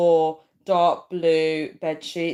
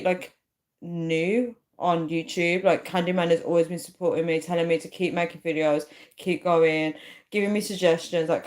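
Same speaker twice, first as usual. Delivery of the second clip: normal talking voice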